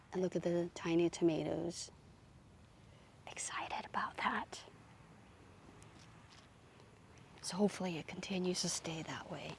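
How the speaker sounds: hushed whisper over the plant. Real, not acted. Gardener showing off tiny tomatoes, worried about pests, whispering. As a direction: drop to a secretive whisper. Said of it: "So I'm gonna whisper again".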